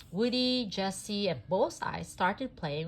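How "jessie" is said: plain reading voice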